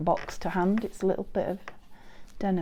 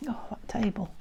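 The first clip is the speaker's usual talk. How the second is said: usual speaking voice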